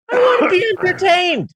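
sudden high shouted whine; mock demand. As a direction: yell it like a bored, spoiled kid. Mock demand at bad film.

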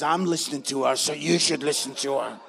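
deep, loud divine advice; God's voice. Retold or imitated. God warns husband to heed his angry wife, deep and loud. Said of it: "God says".

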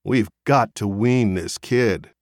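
plain reading voice

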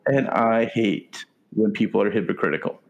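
ordinary delivery

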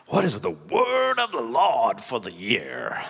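put-on high, drawn-out American accent. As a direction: ham up an American accent, stretching the words out. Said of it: "I did an American accent there".

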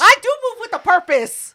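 high, loud indignant comeback; loud defensive retort. Real, not acted. Answering her friend's jab, loud and indignant. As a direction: snap back at the criticism.

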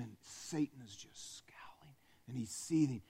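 softly narrating a scowling devil; whispered narration. A narrator's reading. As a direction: let the seething sit in a whisper. Narrating Satan seething.

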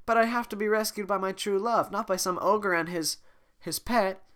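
high loud haughty princess. Acted. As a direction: look down on him, loudly. Voicing Fiona.

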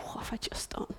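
hushed dismayed whisper; regretful whisper. Acted. Bride voiced in regret.

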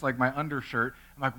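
ordinary talk

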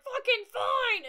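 a high, loud, slow, strangled yell; hammed-up cry of giving in under pain; imitating Frank yelling as he's stabbed; mock pained yell. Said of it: "and he's like".

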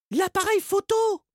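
put-on high eager girl; Margot's voice. A narrator's reading. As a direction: urge him quickly. Margot speaks to Salim.

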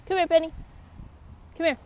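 loud, high call; calling the dog. Real, not acted. Owner calling her running dog, loud high call. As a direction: call her back across the field.

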